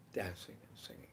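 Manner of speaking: low, soft near-whisper; hushed recollection. Real, not acted. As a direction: let dancing and singing drop away quietly. Same words repeated in a hush.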